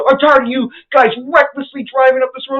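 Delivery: high, loud parody of a furious neighbour; mock angry yell. The driver yelling at him from the road, high mock anger. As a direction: yell it like a self-righteous stranger.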